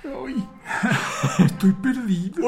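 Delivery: put-on high, slow, plaintive old-turtle voice; sea turtle voice. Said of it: "ahí sale con su voz de". (una tortuga de mar perdida, voz aguda y lenta)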